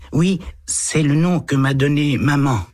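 put-on a small boy answering a policeman shyly; little boy. A narrator's reading. Eight-year-old boy answers the lieutenant, timid child voice. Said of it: "explique le petit garçon".

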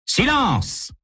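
very high-pitched cry for silence; booming command. A narrator's reading. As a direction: cut in with an imperious, high-pitched call. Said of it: "gronde une voix d'homme à sa gauche".

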